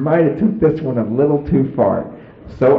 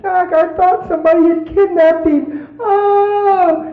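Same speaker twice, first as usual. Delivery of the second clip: high, loud hammed-up wail; imitating a wailing sob; mock crying her eyes out; 6 dB louder, slower than usual. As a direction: bawl it like a hysterical wife. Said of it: "she was crying her eyes out".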